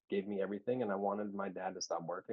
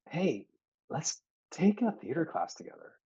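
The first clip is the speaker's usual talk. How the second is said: higher, bright coaxing invite; eager friend; 8 semitones higher than usual. Retold or imitated. Friend urging him to join class, eager and higher. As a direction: pitch it up like an excited buddy.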